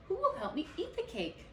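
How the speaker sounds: high, laughing offer; giggly teasing hen; laughing tease. Acted. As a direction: dangle the prize with a chuckle.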